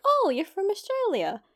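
high-pitched mock surprise; surprised waiter. Waiter guessing the diners' country, mock surprised. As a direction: imitate a waiter's high "oh!" of discovery.

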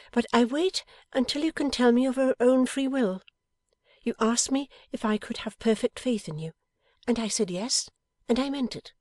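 high wifely voice, sincere and firm; high-pitched loyal wife; devoted wife, earnest. A narrator's reading. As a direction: pledge your faith in a high, earnest voice.

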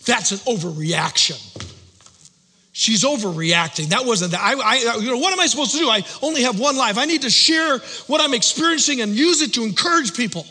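loud, high, defensive protest; self-justifying outburst, loud and high. Retold or imitated. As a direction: argue your case, indignant and loud. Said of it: "So I'm being all defensive inside".

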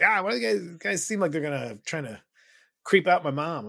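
high, loud exasperated griping; mock indignant complaint. Young fan complaining at a menacing band, mock indignant. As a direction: protest like a put-out kid, playfully.

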